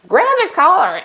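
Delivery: put-on high, insistent old man's call; imitated shout from the porch. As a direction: call the order out, high-pitched. Old man repeats his called order.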